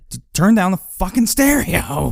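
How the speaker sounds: high-pitched exasperated holler, retold; mock an irate yell over the music; imitated angry yell. Man yelling at party guest to turn down stereo, mock angry yell.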